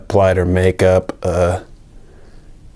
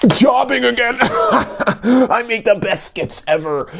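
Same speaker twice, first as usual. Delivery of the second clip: laughing; 14 semitones higher than usual. Real, not acted. Laughs at own joke.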